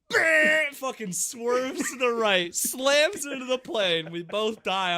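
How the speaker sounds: loud, high, slow laughing retelling; excited yelling. Real, not acted. Retelling his friend's crash, loud, high and laughing. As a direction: shout the crash story through tears of laughter. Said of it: "what I was screaming".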